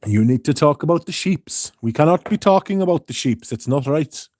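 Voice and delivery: hammed-up South African protest; badly attempted South African accent. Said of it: "That South African accent".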